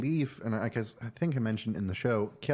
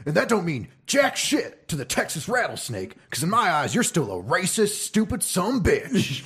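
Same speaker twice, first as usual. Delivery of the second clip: high Stone Cold promo, slowing at end; wrestling voice; 8 semitones higher than usual. Retold or imitated. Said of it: "read it for you in the wrestling voice".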